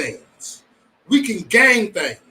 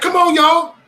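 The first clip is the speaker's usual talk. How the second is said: high, loud mock protest; exasperated plea; 12 semitones higher, 6 dB louder, slower than usual. Acting out his reaction to the chat, high exasperated plea. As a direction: plead loudly, pitched way up.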